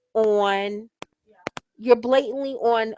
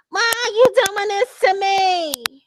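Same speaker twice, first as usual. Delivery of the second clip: high, loud, drawn-out fake wail; mock bawling; 10 semitones higher, 8 dB louder, slower than usual. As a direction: bawl it out, mimicking a melodramatic crybaby. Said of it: "don't fucking start bawling out, crying".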